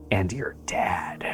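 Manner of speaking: unvoiced whispered reveal. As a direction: breathe it like a secret.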